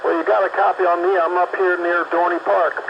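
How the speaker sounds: ordinary talk, nothing marked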